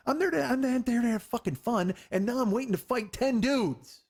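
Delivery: high-pitched, indignant rant; worked-up exasperation. Retold or imitated. Venting about having to fight ten dudes, worked-up exasperation. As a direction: protest the injustice, pitch way up. Worked up reliving the ruined night.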